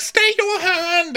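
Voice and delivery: very high, drawn-out royal yell; hammed-up cry to halt an attack; mock commanding shout. Acted king halting an attack.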